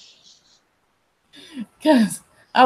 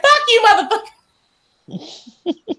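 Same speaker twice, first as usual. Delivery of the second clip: retold shout back; 10 semitones higher than usual. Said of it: "I was like".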